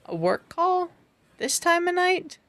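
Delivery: high-pitched, sceptical questioning; incredulous retold question.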